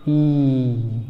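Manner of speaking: ई held long; teacher demo of long ee. Shown as a demonstration. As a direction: stretch ई into a long ee. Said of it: "क्या बोलेंगे?".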